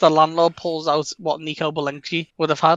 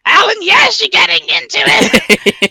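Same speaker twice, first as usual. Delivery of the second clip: high, loud, drawn-out landlord cry; hammed-up bloodthirsty excitement; mock manic glee; 14 semitones higher, 5 dB louder, slower than usual. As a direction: crow it slowly in a put-on high voice. Landlord urges Alan to enjoy bloodshed.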